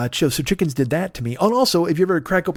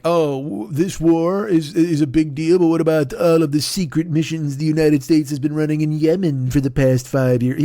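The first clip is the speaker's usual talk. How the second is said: mock whiny critic. Mocking people who talk about the war.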